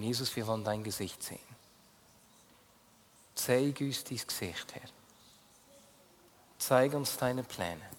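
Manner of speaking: usual speaking voice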